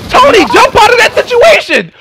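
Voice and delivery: high urgent call out; frantic yell at teammate. Real, not acted. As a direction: shout the warning across. Yelling at teammate in game.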